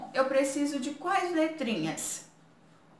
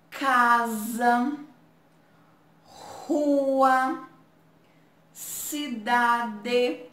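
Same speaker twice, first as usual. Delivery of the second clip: reading aloud; much slower than usual. Shown as a demonstration. Teacher leading the class reading the word list aloud. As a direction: read the words like a chant for children. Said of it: "Agora vamos ler essas palavrinhas?".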